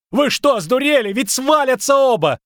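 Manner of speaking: high outraged yell; frantic high-pitched protest; shouting. A narrator's reading. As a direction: shout at idiots in panic. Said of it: "кричал Лютик".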